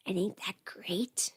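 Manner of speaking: hushed, pleased aside; whispered aside. Real, not acted. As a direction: sly whisper. Whispered aside about no genitals.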